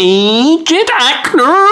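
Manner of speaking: high, slow, strained reversed voice; backwards speech. Shown as a demonstration. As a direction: garble it like tape played backwards. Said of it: "That's actually Diana Rigg backwards".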